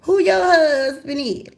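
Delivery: put-on high, slow young girl. The girlfriend asking the wife, high and slow. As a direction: ask it like a confused teenager. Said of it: "girlfriend asks".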